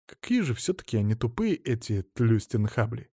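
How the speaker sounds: ordinary delivery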